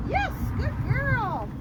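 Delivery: slow, high, sing-song praise for the dog; drawn-out praise. Real, not acted. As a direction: stretch out the praise to reward her.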